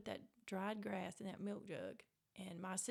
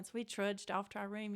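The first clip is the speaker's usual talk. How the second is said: normal talking voice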